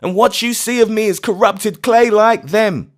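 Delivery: high, loud frantic refusal; shrieking refusal. Acted. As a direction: refuse to leave the graves, shrieking. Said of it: "he cried with a shriek".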